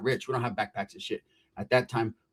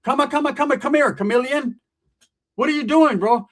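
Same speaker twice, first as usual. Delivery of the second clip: higher-pitched, urgent hailing; confronting callout; 7 semitones higher, 5 dB louder than usual. Retold or imitated. Storyteller confronts a strange man, urgent callout. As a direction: call him over, demanding answers. Calling out and confronting [name].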